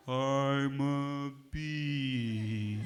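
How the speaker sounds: I'm a bee dragged out extremely slowly and low; mock a lazy, sluggish bee. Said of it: "would be really slow".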